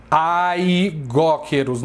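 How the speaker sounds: aigóqueros with an open "ai" diphthong at the start; drawn-out "ai" opening on aigóqueros; wrong pronunciation. Shown as a demonstration. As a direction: say aigóqueros, sounding both vowels A-I slowly. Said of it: "não se fala".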